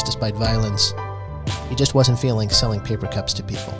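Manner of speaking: normal talking voice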